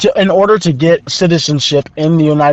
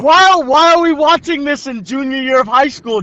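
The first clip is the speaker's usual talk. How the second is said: high-pitched incredulous complaint; exasperated; 9 semitones higher than usual. Real, not acted. Junior complaining about high school lesson, exasperated. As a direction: demand an explanation, pitch way up.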